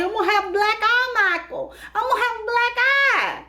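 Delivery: high, whiny complaining voice in a put-on tone; high whiny Angela. Angela whining to Michael about her eye, high and whiny. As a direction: whine it like a hurt, pouting woman. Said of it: "she was like".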